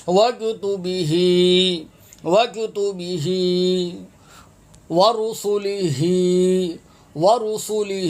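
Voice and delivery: "وکتبہ", "ورسلہ" chanted with a long final hee; sounded h. Shown as a demonstration. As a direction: recite "وکتبہ", "ورسلہ" ending on a drawn-out, audible hee. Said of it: "ہا کی آواز آئے گی".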